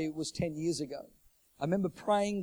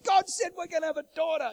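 excited announcement; 14 semitones higher, 6 dB louder than usual. Retold or imitated. Husband announces prophecy.

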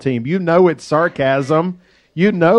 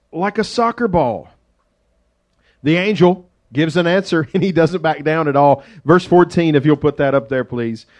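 ordinary delivery

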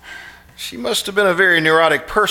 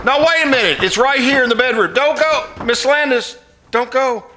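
calling out; 8 semitones higher, 3 dB louder than usual. Acted. Calling after departing visitor.

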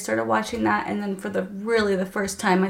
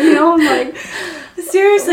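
high and loud, laughing hard; can't hold back the giggles; loud, high, laughing aside; real laughter; 9 semitones higher, 12 dB louder than usual. Real, not acted. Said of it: "laughing at her own story".

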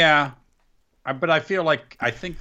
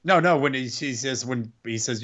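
ordinary delivery

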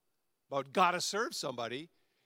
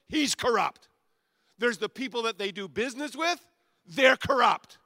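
higher and louder, each corrupt hammered harder; building preaching; 6 semitones higher, 8 dB louder than usual. Real, not acted. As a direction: pile it up, punching corrupt every time.

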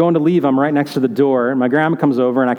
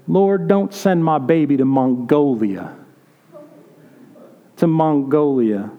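imitating grandma weeping; slower than usual. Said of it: "she begins to weep".

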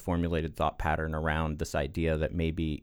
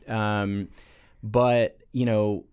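ordinary talk, nothing marked

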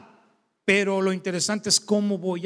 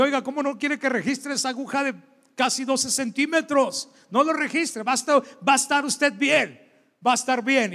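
reassuring acupuncturist; 6 semitones higher than usual. Retold or imitated. Acupuncturist voiced in anecdote.

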